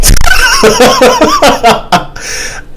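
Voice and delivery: very high-pitched cackling laughter; hearty amused laugh. Real, not acted. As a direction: crack up at your own silly act. Cracks up at own impression.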